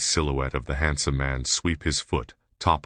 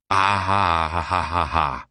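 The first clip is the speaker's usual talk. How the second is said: louder, slow maniacal cackle; villain cackle; 3 semitones higher, 5 dB louder than usual. A narrator's reading. Villain cackling as his gun fires, maniacal laugh. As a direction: cackle like a gleeful madman. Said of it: "Diago cackles".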